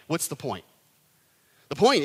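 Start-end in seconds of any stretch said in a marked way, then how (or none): none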